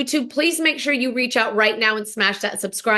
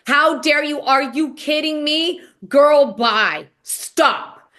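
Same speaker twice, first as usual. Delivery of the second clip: slow, loud accusing outburst, a bit higher; furious accusation; 4 semitones higher, 4 dB louder, slower than usual. Retold or imitated. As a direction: spit out each word like a charge of betrayal. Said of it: "she made more noise in this one interaction".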